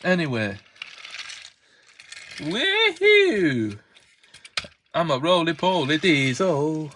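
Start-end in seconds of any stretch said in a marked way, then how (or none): 2.4-3.8 a whoop that shoots much higher; excited whoop. Acted. A roly-poly diesel cheers about himself with a high whoop. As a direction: whoop for joy.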